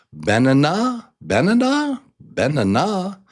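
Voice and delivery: high questioning banana, as ben-a-NAH; wrongly stressed banana. Shown as a demonstration. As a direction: query banana, weight on the final NAH. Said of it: "I'll say it for you wrong".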